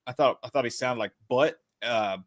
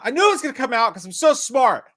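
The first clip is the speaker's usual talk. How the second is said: mock boast; 9 semitones higher, 6 dB louder than usual. Joking boast about trailer release.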